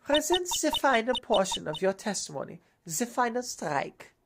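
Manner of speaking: put-on stern prosecutor with a German accent; haughty German-accented prosecutor. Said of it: "a German accent instead of Russian now".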